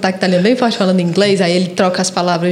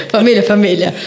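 laughing; 6 semitones higher than usual. Real, not acted. Laughing at border story.

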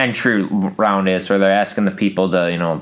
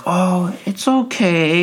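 much higher mock soothing; mock consoling; 9 semitones higher, much slower than usual. Friends consoling after backfire, higher mock soothing. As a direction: parody sweet comfort. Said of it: "they're like".

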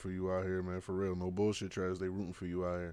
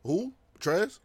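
surprised; 11 semitones higher, 8 dB louder than usual. Real, not acted. Hearing a shocking name.

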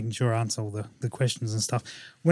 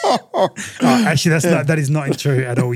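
laughing protest; 4 dB louder than usual. Real, not acted. Co-host teases about rich snobs, laughing protest. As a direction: protest through the tail of a laugh.

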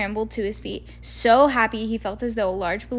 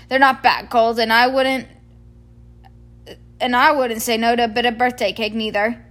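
loud hearty giant just woken, louder; booming cheerful giant ready to go; Hagrid the giant; 5 dB louder than usual. Acted. Hagrid voiced in story.

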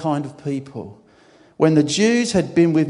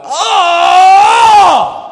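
a huge pained cry of a groan; shouted groan of grief; loud anguished groan; 14 dB louder than usual. Shown as a demonstration. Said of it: "I think he groaned like".

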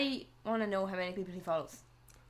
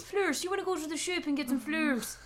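higher-pitched exaggerated Irish lilt; mock Irish accent; 6 semitones higher than usual. Friend joking about buying flowers, mock Irish accent. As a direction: ham up the Irish accent, playfully. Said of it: "we say fleurs instead of flowers".

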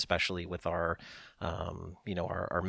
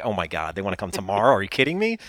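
retold disbelief; 8 dB louder, faster than usual. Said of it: "And I was like".

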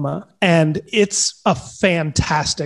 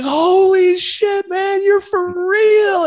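imitating a thrilled, drawn-out high squeal; retold amazed excitement; much slower than usual. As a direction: stretch it slowly, way up high, awestruck. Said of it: "he was just so excited".

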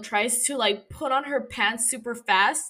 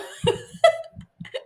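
high breathy laugh; 4 dB louder than usual. Real, not acted. Laughs at own joke.

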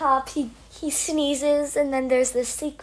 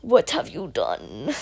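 low, soft stern dad voice; stern father; 8 semitones lower, 5 dB quieter than usual. Retold or imitated. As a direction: lower and quieter, like a grave father. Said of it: "appears out of nowhere and says".

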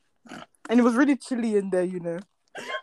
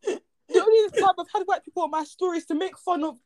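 indignant; 8 semitones higher, 3 dB louder than usual. Real, not acted. Loud indignant denial.

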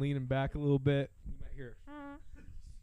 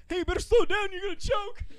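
high, loud mock warning; high mock scolding; 6 dB louder than usual. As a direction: shout a nagging warning, high. Mock scolding while eating.

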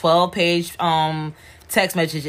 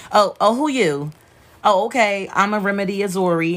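Remy impression; 6 semitones higher than usual. Sassy cousin announcing herself to strangers, brash higher voice. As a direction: play the bold cousin who makes sure you know her. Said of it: "she made sure you knew who the fuck she was".

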